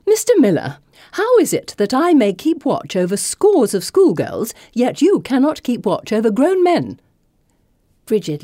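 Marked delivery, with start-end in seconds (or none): 0.1-7.0 higher, louder sharp rebuke; indignant scolding. Acted. Headmistress scolds the foreman over his lost man, loud indignant rebuke. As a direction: dress him down in front of everyone.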